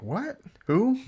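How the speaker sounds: high rising mock surprise; high bewildered what who; baffled. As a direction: squeak it out, confused. Retold confused fan.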